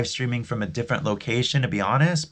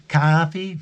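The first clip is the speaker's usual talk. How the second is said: put-on New York accent; 6 semitones higher, 3 dB louder than usual. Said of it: "don't ask me to do a, a New York accent".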